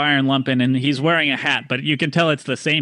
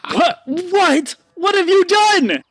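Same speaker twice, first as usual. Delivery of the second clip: high, loud shocked merchant; high indignant squawk; outraged shock; 5 dB louder, slower than usual. Acted. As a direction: shriek in disbelief at the broken goods. Merchant outraged at broken axe.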